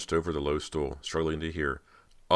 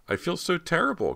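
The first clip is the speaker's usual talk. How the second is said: upset; 3 dB louder than usual. A narrator's reading. Cody apologising.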